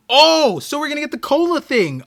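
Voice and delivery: high, fast excited burst. Real, not acted. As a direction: blurt it out, thrilled. Excited at cola reveal.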